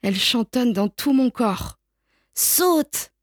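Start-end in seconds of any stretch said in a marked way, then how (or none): 2.4-3.1 troisième appel plus aigu; répète l'ordre, haut et pressant; crié. A narrator's reading. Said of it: "crie mon frère une troisième fois". (le frère crie une troisième fois, plus aigu)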